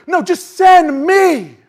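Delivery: slow loud high shout; shouted plea. Acted. Isaiah answering God, slow loud shout. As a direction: cry it out, slow.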